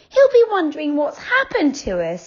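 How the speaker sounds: put-on high, anxious little girl; worried girl Franny. A narrator's reading. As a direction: fret about their friend. Franny voiced.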